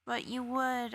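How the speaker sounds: ordinary delivery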